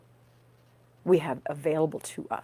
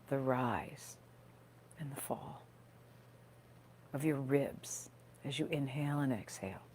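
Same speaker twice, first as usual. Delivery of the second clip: guided meditation. Real, not acted. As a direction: gently guide listeners into their breath, leaving space between phrases.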